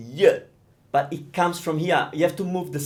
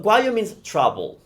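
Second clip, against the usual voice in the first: ordinary talk, nothing marked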